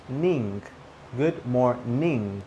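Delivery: slow GOOD MORNING ending in a full "ning". Shown as a demonstration. Teacher drilling the second way, MORNING as slow "mor-ning". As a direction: finish MORNING on a clear NG.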